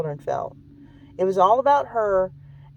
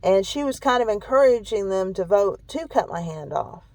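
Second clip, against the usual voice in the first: ordinary delivery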